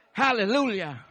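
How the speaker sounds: ordinary talk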